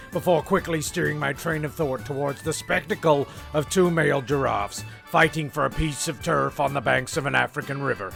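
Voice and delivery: normal talking voice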